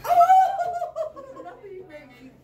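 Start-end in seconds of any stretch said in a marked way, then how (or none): 0.2-1.4 real giggly cackle of a drugged patient; loud high laugh. Real, not acted. Bursts out laughing after flirting.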